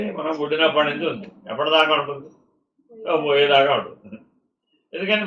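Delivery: ordinary talk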